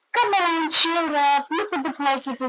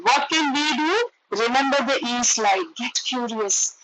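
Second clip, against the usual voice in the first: normal talking voice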